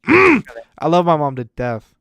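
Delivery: love my mom to death, said loud and drawn out; loud, slow declaration. Real, not acted. Son proclaiming love for his mom, loud and slow. Repeats line louder, slower.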